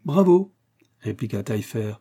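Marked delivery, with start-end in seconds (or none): 0.1-0.5 loud, raised bravo; hearty cheer. A narrator's reading. Taillefer applauding Raphaël at the banquet, cheering. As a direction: cheer him on with a high, strong voice.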